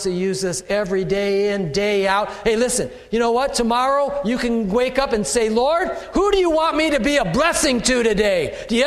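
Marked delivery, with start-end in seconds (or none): 5.5-8.6 eager prayer. Retold or imitated. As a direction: ask it like an eager believer starting the day. Model prayer each morning.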